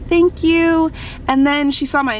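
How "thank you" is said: higher, mimicked idol thank-you; lightly voiced sweet idol; idol's reply. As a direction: imitate a gracious pop idol.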